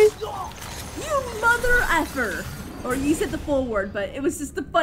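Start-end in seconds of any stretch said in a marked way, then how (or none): 0.0-2.4 high, slow imitation of a censored curse; imitated funny swear. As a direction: mimic a comic curse, drawn out and softer. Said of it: "It's the way you said it too".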